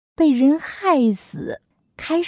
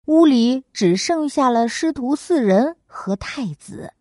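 plain reading voice

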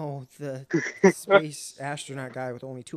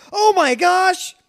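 panicked exclamation; 15 dB louder, much slower than usual. Retold or imitated. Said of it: "all you hear is".

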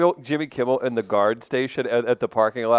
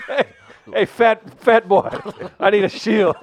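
mock call for shield; 7 semitones higher, 6 dB louder than usual. Calling out in mock cold.

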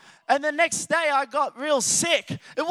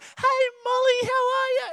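much higher sing-song dog-greeting voice; talking to a dog; 12 semitones higher than usual. Retold or imitated. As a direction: coo at the dog in a high voice.

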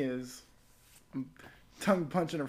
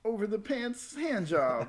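slow, higher game show answer voice; 7 semitones higher, slower than usual. Acted. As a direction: announce it like a quiz answer, slowly. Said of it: "Say it again?".

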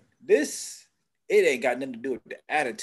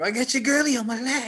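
high, taunting trash talk; lightly voiced trash talker; mocking quote; 9 semitones higher than usual. Mock quote of a player.

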